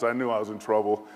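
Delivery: ordinary talk, nothing marked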